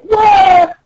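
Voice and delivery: high-pitched cartoon wail; hammed-up "waah"; mock crying. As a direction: bawl like a tiny captive princess. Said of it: "you hear someone crying".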